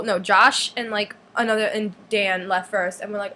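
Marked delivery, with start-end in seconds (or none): none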